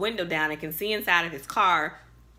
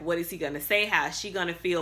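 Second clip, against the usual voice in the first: usual speaking voice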